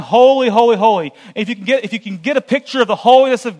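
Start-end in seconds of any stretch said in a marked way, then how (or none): 0.0-1.1 loud, ringing cry of praise; preacher voicing the angels' cry, loud and ringing; angels crying holy. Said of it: "of the angels crying".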